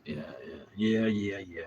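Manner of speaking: normal talking voice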